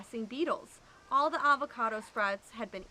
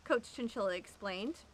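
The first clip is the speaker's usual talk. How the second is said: plain reading voice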